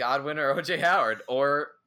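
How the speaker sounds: laughing through the list. Real, not acted. Laughing at his own joke.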